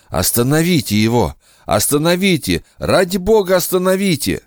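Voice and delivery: high, pleading, panicked cry; desperate scream. Acted. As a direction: beg at the top of your voice. Soldier relives battle, begs.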